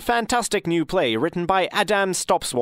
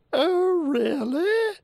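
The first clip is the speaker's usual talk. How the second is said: much higher, surprised squeak; high squeaky voice; 12 semitones higher, much slower than usual. Acted. Second voice in the play.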